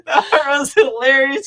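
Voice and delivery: high, drawn-out words through laughter; cracking up while talking; laughing. Real, not acted. Still laughing at the retold scene.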